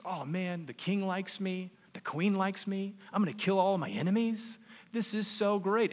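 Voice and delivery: gloating Haman. Retold or imitated. Preacher voicing Haman crowing over his good fortune. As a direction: crow over your luck like a smug villain.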